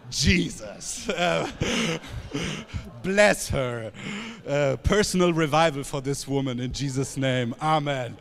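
fervent prayer in English; spoken prayer. Real, not acted. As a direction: pray over her with conviction. Prays aloud in English.

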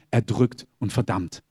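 ordinary talk

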